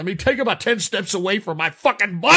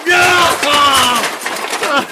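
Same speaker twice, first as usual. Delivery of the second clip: despairing cries; 2 semitones higher, much slower than usual. Real, not acted. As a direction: wail in mock despair. Host despairing at pony callers.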